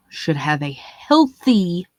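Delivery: slow, drawn-out healthy; stress on healthy. Shown as a demonstration. Comic stressing his point to the audience, slow emphasis on healthy. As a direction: lean into the word healthy. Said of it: "I'm putting emphasis on this word".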